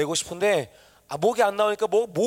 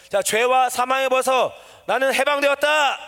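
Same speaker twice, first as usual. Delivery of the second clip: raised, ringing declaration of liberation; exultant proclamation; 4 semitones higher than usual. Shown as a demonstration. Preacher modelling the verse for a youth, exultant proclamation. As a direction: proclaim it with the thrill of being freed. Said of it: "다시 한번 해줄게".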